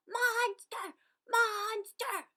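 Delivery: high loud cheering. A narrator's reading. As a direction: cheer after him. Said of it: "they cheered after him".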